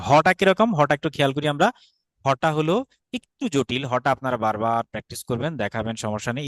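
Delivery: ordinary talk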